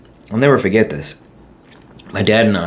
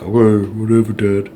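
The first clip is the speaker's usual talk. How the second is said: put-on dismissive kid; 2 semitones higher than usual. Child brushing off dad's warning.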